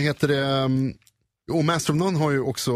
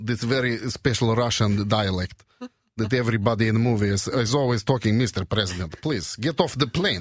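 Russian accent. Shown as a demonstration. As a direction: put on a thick Russian accent. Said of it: "Rysk brytning".

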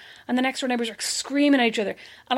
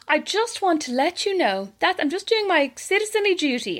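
lightly higher prim voice; prim dutiful citizen; 7 semitones higher than usual. Retold or imitated. Caller reporting to the guards, mock prim and dutiful. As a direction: sound terribly proper and dutiful.

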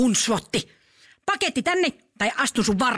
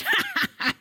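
evil giggle. Acted. Granny gloats over her nut trap.

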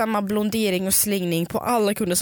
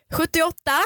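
higher-pitched queue number announcement; mock calling out; 7 semitones higher than usual. Shop clerk calling the next queue number, mock shout. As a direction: call it out like a shop clerk, without real volume. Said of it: "de skriker ut".

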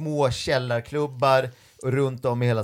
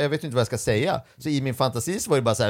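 ordinary delivery

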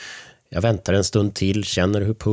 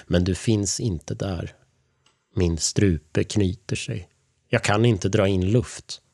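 ordinary delivery, nothing marked

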